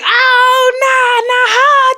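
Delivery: high, loud wail of disbelief broken by laughter; mock dismay. Acting out losing a friend for viewers, high laughing wail. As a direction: act out the gut-punch with a laughing, crying squeal. Acting shock at God's removal.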